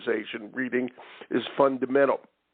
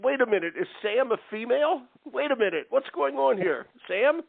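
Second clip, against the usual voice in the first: real surprise, pitched much higher and rising; a genuine double take at the female voice; caught off guard; 9 semitones higher than usual. Real, not acted. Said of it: "You caught me off guard there".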